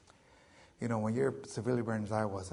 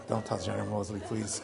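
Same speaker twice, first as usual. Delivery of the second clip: usual speaking voice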